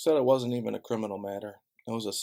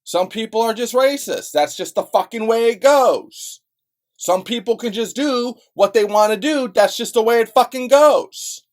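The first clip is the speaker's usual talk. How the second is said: much higher and louder, slow and bitter; bitter yelling rant; furious shout; 10 semitones higher, 10 dB louder than usual. Real, not acted. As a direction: hammer each phrase out in rage. Furious rant about racism.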